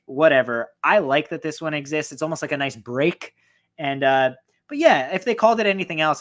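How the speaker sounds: ordinary talk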